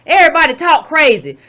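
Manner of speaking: ordinary talk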